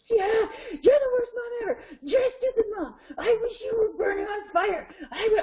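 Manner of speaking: high-pitched wailing tantrum, imitated; child going nuts. Child raging at mom, imitated meltdown. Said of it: "just like, ah, going nuts with this".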